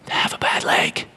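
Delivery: whispered punchline, very soft; hushed whisper to the congregation. Real, not acted. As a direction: let the truth land in a whisper. Sermon point, hushed.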